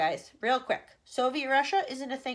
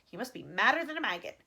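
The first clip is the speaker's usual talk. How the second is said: ordinary delivery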